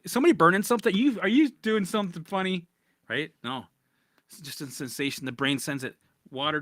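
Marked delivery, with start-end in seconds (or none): 0.0-2.7 alarmed. Retold or imitated. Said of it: "I'm like".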